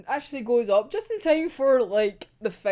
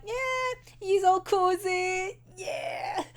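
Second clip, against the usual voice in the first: much higher, lilting mocking voice; smug villain voice; 8 semitones higher, much slower than usual. Imitating Mori gloating over the hostages, higher and sly. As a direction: play the smug villain lounging. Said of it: "being like".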